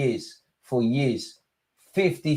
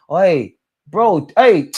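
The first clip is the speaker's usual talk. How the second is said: high, raised urging; urgent call; 7 semitones higher than usual. Real, not acted. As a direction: push the team to clamp him. Urging team to mark player.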